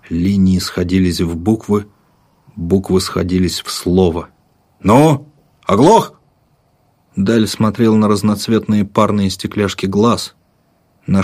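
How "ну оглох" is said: slow, high, loud mocking bark; impatient soldier. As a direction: needle him, fed up.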